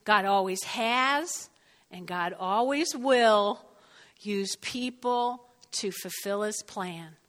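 slow, spaced dictation pace; measured, deliberate dictation. Shown as a demonstration. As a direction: say it slowly so they can write. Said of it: "write this down".

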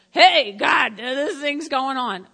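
hey up to God, bright and loud; calling out to God. Retold or imitated.

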